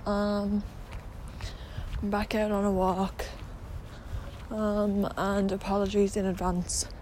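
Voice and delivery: talking around a retainer; slightly lisped, retainer in mouth; wearing a retainer. Real, not acted. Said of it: "that I have my retainer in".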